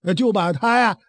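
plain reading voice

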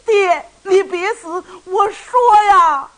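sobbing, rising to a high, loud wail; a son's crying shout, slow and high-pitched; crying plea. Acted. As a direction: beg your father through tears, slow and strained. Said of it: "雷霆也哭了".

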